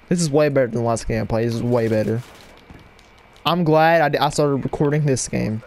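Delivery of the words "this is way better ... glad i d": ordinary delivery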